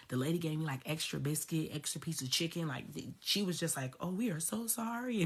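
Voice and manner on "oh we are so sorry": higher-pitched imitation of an apologizing server; apologetic server. Popeyes lady to angry customer, apologetic imitation. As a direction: mimic her apology, pitched well up.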